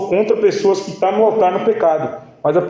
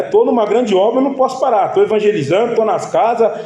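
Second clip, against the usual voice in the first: higher, insistent pulpit refrain; preaching at full force; 5 semitones higher than usual. Acted. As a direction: drive the congregation with each phrase. (pregação inflamada, voz bem mais alta)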